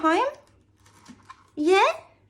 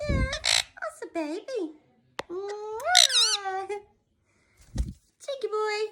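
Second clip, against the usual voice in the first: put-on sing-song cooing baby talk, a touch higher; pet baby talk; 8 semitones higher, 4 dB quieter, slower than usual. Owner cooing at her cheeky pet boy, sing-song baby talk.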